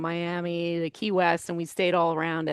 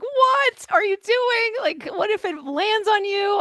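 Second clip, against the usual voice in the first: alarmed daughter; 12 semitones higher than usual. Retold or imitated. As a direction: call out worried, pitched way up. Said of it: "I was like".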